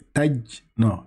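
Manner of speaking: ordinary talk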